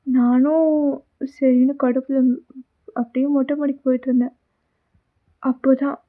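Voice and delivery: loud, bright storyteller address to viewers; loud narration to the viewers; story narration to audience. Acted. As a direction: tell the audience the tale, loudly.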